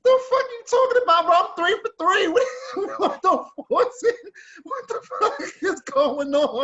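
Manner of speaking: outraged but cracking up; telling the story, laughing at the absurd advice; laughing disbelief. Real, not acted.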